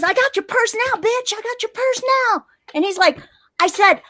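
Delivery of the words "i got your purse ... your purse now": high-pitched mock gloating; imitated robber. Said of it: "he goes".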